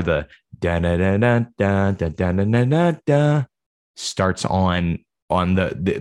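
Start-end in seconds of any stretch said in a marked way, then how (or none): 0.0-3.4 imitated guitar riff. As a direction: hum the entrance riff like a guitar.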